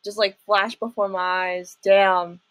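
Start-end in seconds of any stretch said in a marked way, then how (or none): none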